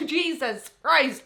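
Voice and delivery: slow, strained after a coughing fit; recovering from choking on spit. Real, not acted. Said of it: "I choked on my own spit".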